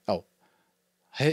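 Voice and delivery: plain reading voice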